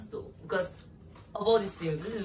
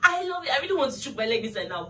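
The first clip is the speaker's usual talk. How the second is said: thrilled; 6 semitones higher, 5 dB louder, faster than usual. Real, not acted. Thrilled unboxing.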